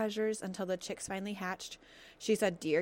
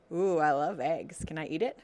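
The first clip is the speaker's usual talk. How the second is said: greedy fox; 2 semitones lower, 7 dB louder than usual. Acted. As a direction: savor the word eggs like a treat. Hungry fox wants the egg.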